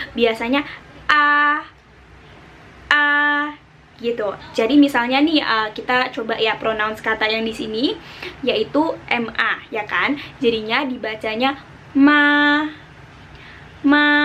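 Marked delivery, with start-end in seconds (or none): 0.1-3.1 A held level and high; first tone, level and high. Shown as a demonstration. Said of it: "dibaca mendatar dan tinggi". 11.9-14.1 ma held level and high; first tone. Shown as a demonstration. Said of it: "ketika kamu sedang mempronounce nada pertama".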